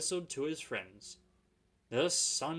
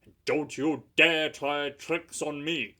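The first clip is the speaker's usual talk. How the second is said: stern warning; 3 semitones higher, 12 dB louder than usual. Acted. Skarloey scolding the coaches.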